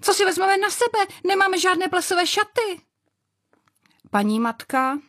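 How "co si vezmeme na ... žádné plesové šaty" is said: put-on high squealing voices of excited girls. A narrator's reading. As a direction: shriek with glee about dresses. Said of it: "jásala a skákala a hned vykřikovala".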